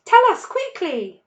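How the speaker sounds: high, loud, impatient push; eager urging. A narrator's reading. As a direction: hurry him along. Mrs Fox urging.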